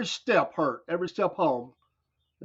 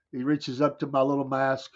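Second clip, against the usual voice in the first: normal talking voice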